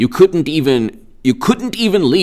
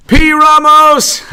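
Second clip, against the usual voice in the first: much higher and louder, stretched; excited shout-out; 13 semitones higher, 5 dB louder, slower than usual. Real, not acted. Vlogger excitedly greeting a chat viewer. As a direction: call the viewer's name with glee. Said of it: "greeting a live chat viewer".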